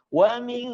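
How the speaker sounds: "Wa min" with long nasal hum; held gunnah. Shown as a demonstration. Teacher says do not rush, held "Wa min". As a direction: hold the n of "min" in the nose. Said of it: "tidak tergesa-gesa".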